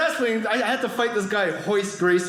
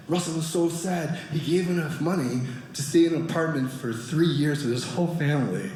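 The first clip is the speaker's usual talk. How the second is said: ordinary talk, nothing marked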